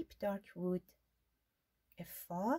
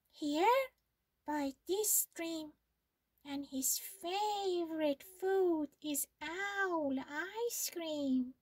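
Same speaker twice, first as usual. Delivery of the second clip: higher mouse voice, teasing threat; sly mouse; 6 semitones higher than usual. Acted. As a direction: savour owl ice cream.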